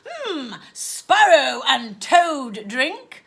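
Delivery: aunt tempting her niece; musing offer. Acted. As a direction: savour the horrid idea.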